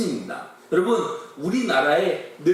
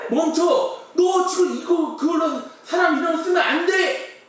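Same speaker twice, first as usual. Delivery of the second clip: panicked shout; 9 semitones higher than usual. Acted. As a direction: yell at him to stop now. Same panic retold in Korean. (높고 크게 다급히 외침)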